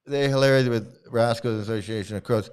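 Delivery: ordinary talk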